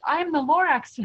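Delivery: high, loud indignant declaration; Lorax announcing himself. Acted. Lorax returning to complain, loud and high. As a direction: burst in proclaiming who you are. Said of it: "that old nuisance Lorax came back with more gripes".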